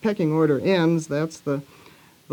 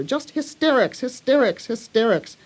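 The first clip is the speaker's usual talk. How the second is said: "hysterics" punched out high, each one separate; stamped-out emphasis; 7 semitones higher than usual. A narrator's reading. Mary stamping at Colin, each "hysterics" hammered out. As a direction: hit every "hysterics" like a stamp of the foot. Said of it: "stamped each time she said it".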